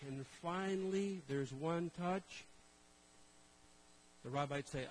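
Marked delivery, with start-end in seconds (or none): 0.1-2.4 drawn-out movie slow motion. Acted. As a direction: stretch it out like a suspenseful film climax. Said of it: "Slow-mo".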